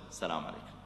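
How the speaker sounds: very soft, low, gentle greeting. Shown as a demonstration. Preacher greeting the angry man, very soft and gentle. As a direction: greet him softly to calm him. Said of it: "يا أخي، قل".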